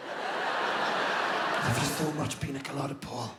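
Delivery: slow, whispered affection for a sleeping man; mock tender whisper. Stroking the sleeping passenger.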